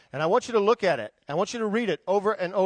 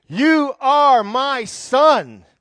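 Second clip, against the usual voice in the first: very slow, louder proclamation; solemn decree; 4 semitones higher, 8 dB louder, much slower than usual. Acted. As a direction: proclaim each word with weight. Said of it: "he said to me".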